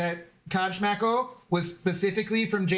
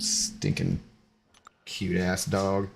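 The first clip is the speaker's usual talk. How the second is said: low growly voice; 9 semitones lower, slower than usual. Real, not acted. Low fond growl at dog.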